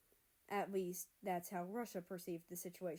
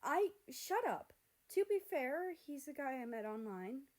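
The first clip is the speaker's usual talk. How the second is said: flustered protest; 6 semitones higher, 3 dB louder than usual. Acted. Flustered America defends his date.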